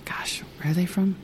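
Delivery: whispering to herself while she tries to remember; whispered aside. Real, not acted. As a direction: mutter it under your breath as you search your memory. Trying to recall a name.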